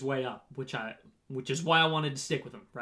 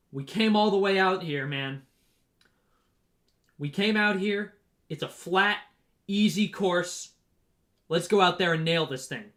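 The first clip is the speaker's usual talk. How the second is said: higher, louder pep talk to the team; hype speech; 4 semitones higher, 5 dB louder than usual. Retold or imitated. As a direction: fire up the teammates before the start. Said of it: "one of our guys gives a speech".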